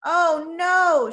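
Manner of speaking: high, loud cry of dismay. A narrator's reading. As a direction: cry out in despair. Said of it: "she cried".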